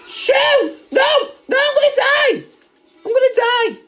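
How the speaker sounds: panicked yelps, high and loud; frantic shouts while under fire; being chased. Real, not acted. Being chased, shot at in game.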